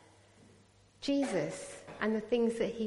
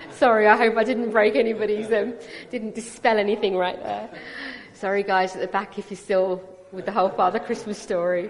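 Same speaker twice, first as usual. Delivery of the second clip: louder, laughing through the sorry; laughing apology; 10 dB louder than usual. Real, not acted. Speaker apologising to the people at the back, laughing. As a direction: apologise while still laughing.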